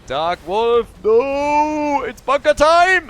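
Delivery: high, loud shoutcaster scream; excited shout. Real, not acted. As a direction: build to a full-voiced yell at the fight's climax. Climax of a team fight.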